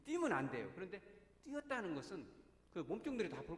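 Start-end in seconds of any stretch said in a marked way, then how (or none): none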